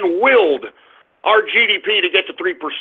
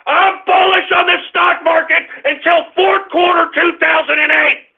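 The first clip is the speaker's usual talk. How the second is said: excited proclamation; 9 semitones higher than usual. Acted. Proclaiming his market view.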